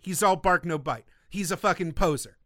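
normal talking voice

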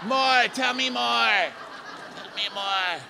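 very high mock shout, falling away in volume; Gia yelling. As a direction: imitate a heckler hollering from the back. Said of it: "Gia's back there like".

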